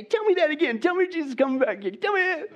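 sarcastic coworkers. Retold or imitated. Preacher mocking coworkers who supposedly love hearing the gospel, sarcastic high voice. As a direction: ham up fake enthusiasm. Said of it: "your coworkers say".

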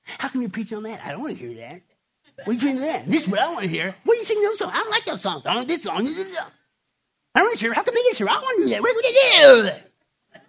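mock-complain like a spoiled member of the flock; whiny churchgoer. Pastor mocking a complaining churchgoer, mild whiny voice. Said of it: "we bring that mentality into the church".

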